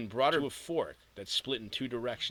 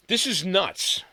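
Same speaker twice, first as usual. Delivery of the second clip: higher, louder exasperated outcry; crying out loud; 7 semitones higher, 7 dB louder, slower than usual. Acted. Worn-out girl crying out to nobody, exasperated and loud. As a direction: throw it out loud in frustration. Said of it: "she cried out loud".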